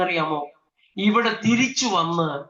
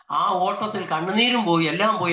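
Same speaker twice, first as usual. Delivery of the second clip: usual speaking voice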